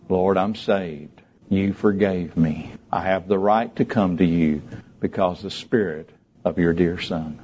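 low, slow prayer voice; measured, reverent sinner's prayer. Real, not acted. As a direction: pray it solemnly, phrase by phrase. Leads a prayer.

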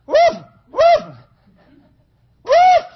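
high, loud mock woofs; imitated dog bark. Preacher imitating his dog wanting food, loud high bark. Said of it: "She used to go like this".

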